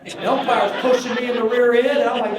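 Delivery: high, loud, laughing outcry; laughing exclaim. Real, not acted. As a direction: squeal it through laughter. Still laughing, umpire pushes.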